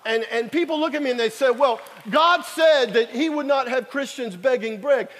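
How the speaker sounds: loud, driving sermon delivery; preaching. Acted. Preacher urging the congregation to beg, loud fervent preaching. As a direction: hammer the point to the congregation.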